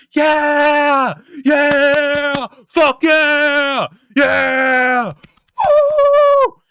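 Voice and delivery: very high, drawn-out triumphant screaming; SpongeBob. Acted. Overjoyed employee screaming in everybody's face, wild high shouting. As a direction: scream each cheer at full tilt. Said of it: "He's, like, screaming in everybody's face".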